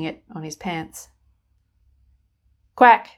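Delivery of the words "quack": loud, higher falling quack; a pointed duck quack. Acted. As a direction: honk it like a duck. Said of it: "says the duck".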